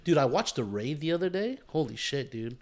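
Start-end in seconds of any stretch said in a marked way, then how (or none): none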